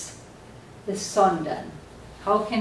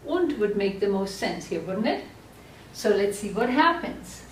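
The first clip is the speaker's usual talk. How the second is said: ordinary delivery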